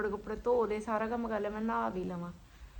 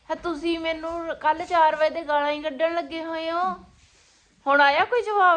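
angry; 5 semitones higher, 6 dB louder than usual. Real, not acted. Wife complains of being scolded.

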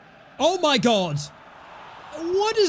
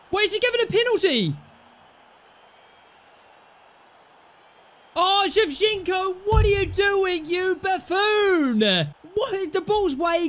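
angry rant; 7 semitones higher than usual. Real, not acted. Gamer yelling at his defender for the penalty, angry shout.

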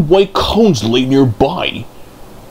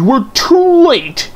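groaning; 12 semitones higher, 3 dB louder, slower than usual. Acted. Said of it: "she groaned".

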